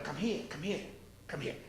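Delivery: very soft, low coaxing; hushed beckoning. Acted. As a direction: beckon the little ones in a near whisper. Mother Goose beckons her chicks.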